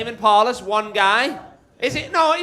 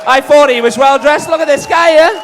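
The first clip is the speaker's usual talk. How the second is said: calls across the room, higher and louder; shouting out; 8 semitones higher, 13 dB louder than usual. Real, not acted. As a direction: hype up the crowd at full volume. Comic calling out to crowd.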